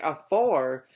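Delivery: loud, offended "A four?"; indignant surprise. Retold or imitated. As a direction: throw the number back at him, affronted. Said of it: "And she's like".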